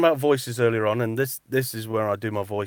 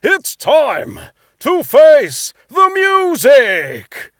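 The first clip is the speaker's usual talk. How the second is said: very slow booming announcer shout, much higher and louder; X Factor announcer shout; 15 dB louder, much slower than usual. Shown as a demonstration. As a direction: boom it out like a TV talent show host. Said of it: "the X Factor one, but you got to shout".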